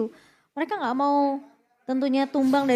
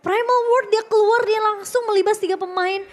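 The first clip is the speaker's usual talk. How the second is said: fighting game announcer; 7 semitones higher, 6 dB louder than usual. Acted. Co-caster hyped commentary.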